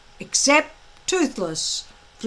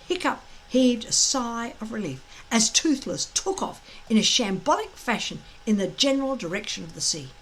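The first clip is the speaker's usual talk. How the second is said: usual speaking voice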